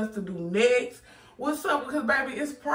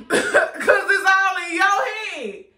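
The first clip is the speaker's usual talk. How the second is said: high, louder line broken by laughter, slowed; laughing taunt; 6 semitones higher, 5 dB louder, slower than usual. Real, not acted. Boyfriend teasing his pranked girlfriend, laughing through it. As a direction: taunt her while cracking up.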